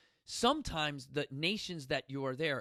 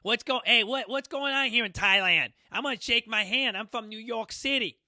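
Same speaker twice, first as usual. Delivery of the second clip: loud, higher, exaggerated New York accent; 5 semitones higher, 7 dB louder than usual.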